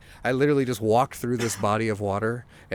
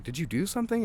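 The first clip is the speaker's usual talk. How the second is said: questioning parents; 7 semitones higher, 3 dB quieter, faster than usual. Retold or imitated. Parents question his paranoia.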